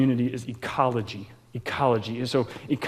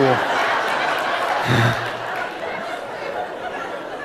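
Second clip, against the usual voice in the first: real laugh; 6 semitones lower, 3 dB quieter than usual. Real, not acted. Laughing at the Ivan voice.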